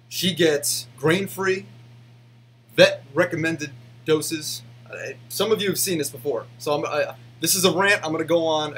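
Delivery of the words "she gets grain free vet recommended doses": each word spelled out slowly; slow and deliberate. Real, not acted. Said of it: "I'm gonna say it like this".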